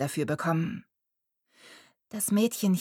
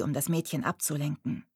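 ordinary delivery, nothing marked

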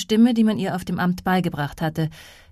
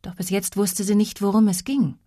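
plain reading voice

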